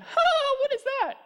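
high, loud sneering "what is that"; a coworker sneering at a Bible, loud and high; mocking coworker. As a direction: scoff in a shrill mocking jeer.

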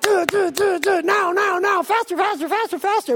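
high, loud, rapid-fire chant; frantic impatience. Acted. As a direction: hammer out each demand in mock frenzy.

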